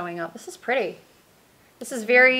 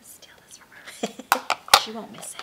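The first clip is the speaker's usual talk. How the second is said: playful conspiratorial whisper. Real, not acted. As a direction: whisper it like a secret. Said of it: "Why are we whispering?".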